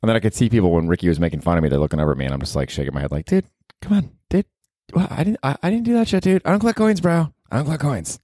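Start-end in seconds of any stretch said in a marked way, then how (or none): 3.2-8.2 high whiny protest; mock protest. Shaking his head at the crowd, high whiny protest. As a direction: whine it up high like a wounded buddy. Protesting Ricky's on-stage teasing.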